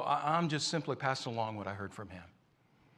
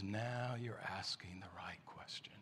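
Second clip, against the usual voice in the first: whisper; 5 semitones lower, 13 dB quieter, slower than usual. Acted. Wise man's punchline answer.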